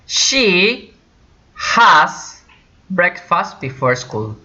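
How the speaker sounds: "She" and "has" high, each set apart; stress on She has. Shown as a demonstration. Teacher models has with she.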